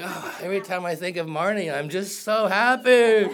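newly in love, gushing about Marnie with a laugh; giddy and lovestruck. Retold or imitated. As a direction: play a swooning new lover gushing, half-laughing. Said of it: "It's like".

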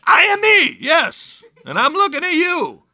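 very high, excited exclamation; excited insistence. Acted. Healed man to neighbors who doubt him, excited insistence.

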